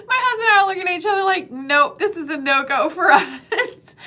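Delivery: laughing. Real, not acted. Laughing while retelling.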